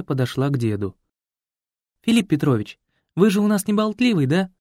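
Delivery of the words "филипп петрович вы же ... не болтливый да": put-on coaxing young woman. A narrator's reading. Young woman coaxing the old man.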